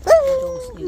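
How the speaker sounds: high loud call to a dog. Real, not acted. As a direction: shout to get the dog's attention. Calling the dog.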